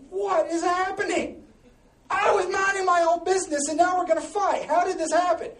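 high, loud husband in hammed-up panic; mock bewildered outcry. Husband suddenly in a fight, hammed-up exasperated shout. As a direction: wail it in comic disbelief, high and loud.

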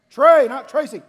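indignant shout. Retold or imitated. Boy shouts at the caller who called him Tracy, indignant. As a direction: yell the correction like an offended kid.

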